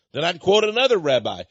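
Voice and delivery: ordinary talk, nothing marked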